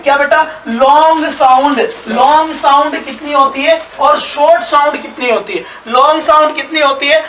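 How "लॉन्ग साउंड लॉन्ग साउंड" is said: slow emphasis. Shown as a demonstration. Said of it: "teacher repeats the key term".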